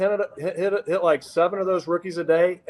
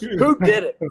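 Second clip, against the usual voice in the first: mock angry demand; 6 semitones higher, 4 dB louder than usual. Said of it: "And he goes".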